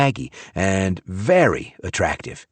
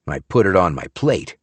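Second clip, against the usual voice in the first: plain reading voice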